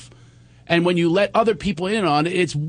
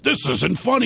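mock-indignant protest, pitched up; playing someone spoiling for a fight; hammed-up mild outrage; combative; 5 semitones higher than usual. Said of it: "you're ready for a boxing match".

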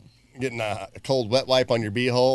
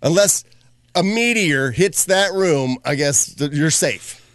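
ordinary talk

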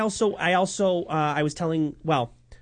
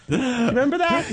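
laughing; 10 semitones higher than usual. Real, not acted. Teasing laugh at friend.

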